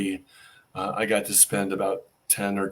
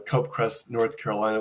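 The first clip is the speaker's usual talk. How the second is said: normal talking voice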